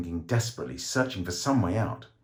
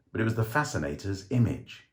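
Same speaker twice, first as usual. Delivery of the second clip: plain reading voice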